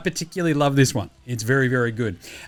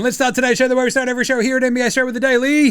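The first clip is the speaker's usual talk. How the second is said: fast, louder horse-race call; race caller; 8 semitones higher, 4 dB louder, much faster than usual. Shown as a demonstration. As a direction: call it like the final turn. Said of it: "Sounding like a race caller".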